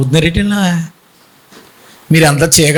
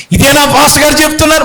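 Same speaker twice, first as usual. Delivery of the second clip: high, fast indignant question; a caller's retold indignation, high and fast; 6 semitones higher, faster than usual. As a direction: mimic the caller's outrage, quick and higher. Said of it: "ఆవిడ అంటుంది".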